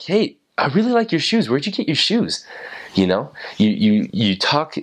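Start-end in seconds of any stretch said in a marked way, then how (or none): 0.0-2.4 bright, much higher friendly opener; cheery stranger compliment. Retold or imitated. Missionary stopping a stranger on the street, eagerly friendly. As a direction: sound delighted by a stranger's shoes. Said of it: "you stop them like".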